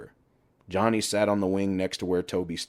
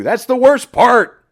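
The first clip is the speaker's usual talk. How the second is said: much higher and louder, slowly stretched; mock outburst; 14 dB louder, slower than usual. Johnny tops his rant off with a loud, slow shout. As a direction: bellow the punchline at him.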